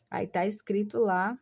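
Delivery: ordinary talk, nothing marked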